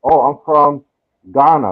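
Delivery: ordinary delivery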